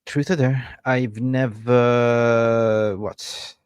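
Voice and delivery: usual speaking voice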